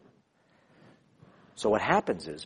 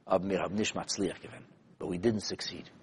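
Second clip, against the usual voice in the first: quiet grief; 5 semitones lower, 10 dB quieter than usual. Retold or imitated. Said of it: "weeping bitterly".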